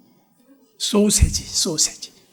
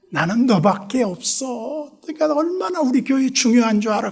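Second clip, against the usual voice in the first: coaxing; 6 semitones higher than usual. Retold or imitated. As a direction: win her back gently. Pastor coaxes the deacon.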